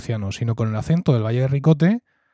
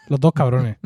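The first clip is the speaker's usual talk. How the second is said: ordinary delivery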